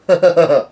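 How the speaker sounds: high, loud burst of laughter; chuckling loudly. Real, not acted. Loud laugh after the quote.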